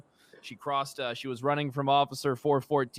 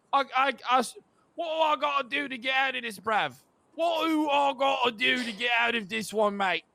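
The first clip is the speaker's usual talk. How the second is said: higher, fast cockney whining that builds to a shout; mock British plea; 9 semitones higher than usual. Officer imitating the suspect begging to get off, British. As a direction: beg like a caught London chancer. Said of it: "before she said".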